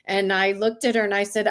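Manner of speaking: ordinary delivery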